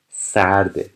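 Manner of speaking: ordinary talk, nothing marked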